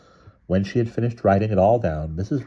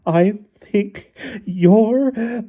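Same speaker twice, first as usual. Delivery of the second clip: very high, slow bawl; wailing; 5 dB louder, much slower than usual. A narrator's reading. Wife losing the car argument, wailing. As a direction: bawl word by word into a long wail. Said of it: "she wailed".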